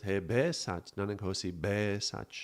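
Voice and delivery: "Be" with open eh, as "beh"; changed vowel. Shown as a demonstration. Teacher quoting the given mantra, "Be" as open "beh". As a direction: say "Be" as "beh", rhyming with "meh". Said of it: "Just changing a vowel".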